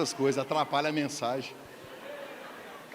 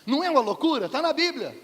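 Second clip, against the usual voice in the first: amazed exclamation; 8 semitones higher, 6 dB louder than usual. Real, not acted. Marvelling exclamation to congregation.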